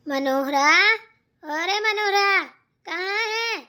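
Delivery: loud, drawn-out call for someone; rising, carrying summons. Acted. Calling out for Manohara to come.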